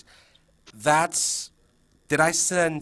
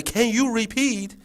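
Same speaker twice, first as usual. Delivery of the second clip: high, slow singsong echo; kids parroting teacher; 9 semitones higher than usual. Acted. Kids copying teacher without understanding, slow parroting. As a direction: echo it blankly word by word like kids. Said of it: "They'll just repeat everything you say".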